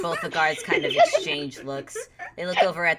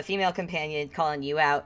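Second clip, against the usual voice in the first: normal talking voice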